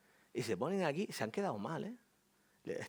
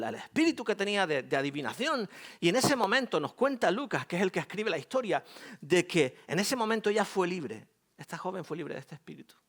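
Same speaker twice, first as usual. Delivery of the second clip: ordinary delivery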